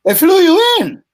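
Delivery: incredulous squeak of "They flew you in?"; astonished. Retold or imitated. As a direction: hit YOU up high in shock. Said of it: "I said, "Yeah".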